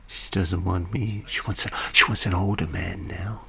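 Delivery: soft, unvoiced gossiping whisper; conspiratorial whisper; whispered aside. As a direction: lean in and whisper it. Gossiping aside about the wife.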